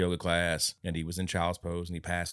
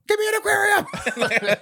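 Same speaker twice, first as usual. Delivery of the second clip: high, loud, stretched-out holler; mock yell; 6 dB louder, slower than usual. Imitating her sudden demand for a tank, yelling. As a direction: yell it out with laughter behind it. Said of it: "just yells it out".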